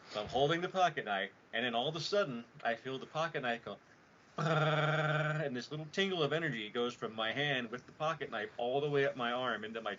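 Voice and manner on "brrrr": loud lip-trilled buzz; sustained vibrating brrr noise; imitated buzz. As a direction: mimic a vibrating object with a brrr. Imitates the pocket knife buzzing.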